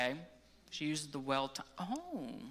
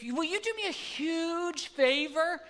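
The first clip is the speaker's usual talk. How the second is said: imitating his wife; 9 semitones higher, slower than usual. Quoting his wife asking favor.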